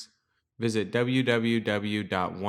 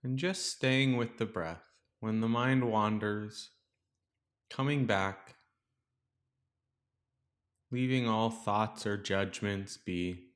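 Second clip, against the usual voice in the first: calm, softer meditation voice with long pauses; guided meditation; 5 dB quieter than usual. Real, not acted. Teacher guiding listeners to settle into the breath, calm meditation voice. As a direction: guide listeners gently into stillness, leaving space between phrases.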